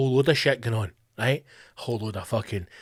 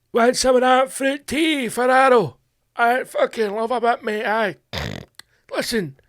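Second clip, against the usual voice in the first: higher, laddish pub patter; voicing a stoned Glasgow-style lad; 7 semitones higher than usual. A lad in a hookah bar talking his pal into it, higher and laddish. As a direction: play the stoned mate chatting up his pal.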